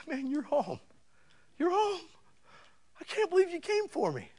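high-pitched overjoyed dog voice; very high, thrilled pet voice; excited dog. Acted. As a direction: squeal like a dog whose owner is finally home. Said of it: "your dog thinks you've been gone for seventeen years".

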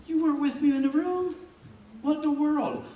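high incredulous retort; mock indignation. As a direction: throw it back in disbelief. Indignant retort to fair-weather friend.

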